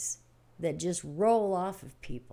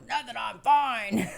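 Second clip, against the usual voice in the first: higher, louder, slow "nothing, I'm fine"; husband's brush-off; 5 semitones higher, 7 dB louder, slower than usual. Retold or imitated. Sick husband to wife, higher, louder, slow brush-off. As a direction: wave off concern while clearly sick. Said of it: "that was the tone of voice he had".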